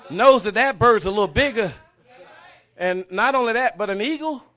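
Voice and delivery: loud, driving preacher's cadence; preaching at full force. Acted. Preacher building up the story.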